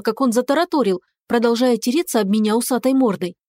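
plain reading voice

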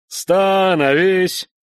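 long drawn-out loud command; shouting drill sergeant. Acted. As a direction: stretch the order into a bellow. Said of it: "зычным командным голосом".

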